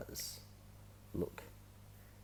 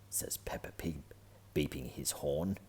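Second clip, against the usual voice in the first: plain reading voice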